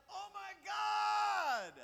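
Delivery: terrified cry. Acted. Atheist cries out in terror as the bear swings, high drawn-out cry. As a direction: wail it out as the bear strikes. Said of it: "the atheist cried out".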